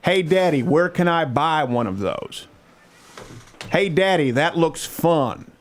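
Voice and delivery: put-on high, childlike voice asking daddy questions; little daughter voice. Father imitating his nine-year-old daughter asking daddy, high childish voice. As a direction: play a curious nine-year-old girl tugging at daddy. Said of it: "questions I want to have to answer my nine-year-old daughter".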